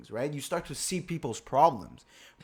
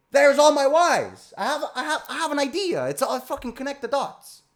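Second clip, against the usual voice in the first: much higher, much louder sudden excitement; eureka shout; 12 semitones higher, 8 dB louder than usual. Acted. Showing viewers his breakthrough, excited high shout. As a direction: yell it like the idea just hit.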